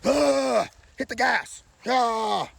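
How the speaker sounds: imitating a guy revving his truck; hammed-up hit the gas shout; mock driver yell. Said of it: "they're like".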